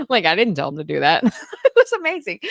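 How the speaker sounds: laughing. Real, not acted. Laughing over son's diligence.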